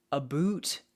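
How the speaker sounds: "A boot" for about, with a long "oo"; Canadian-style about. Shown as a demonstration. Streamer explaining to chat, about as Canadian "A boot". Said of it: "A B O O T".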